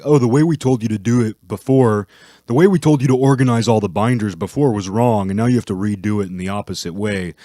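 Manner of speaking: mocking the bosses. Bosses changing instructions, mocking quote.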